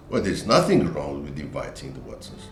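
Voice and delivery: "nothing" stressed with a low fall; low, falling defensive reply; intonation demo. Retold or imitated. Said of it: "argument in a couple".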